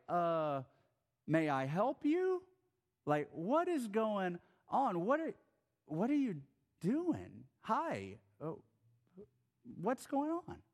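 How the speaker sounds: puzzled, uneasy questions rising high at the end; awkward confusion. Retold or imitated. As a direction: play the startled walker facing a too-close stranger. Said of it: "you're kinda like".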